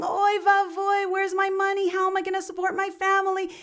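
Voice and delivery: high, hammed-up wail of despair; mock screaming and crying. Robbed peddler wails over his missing wallet, mock screaming. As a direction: wail over the lost money, half acted. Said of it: "he started screaming and crying".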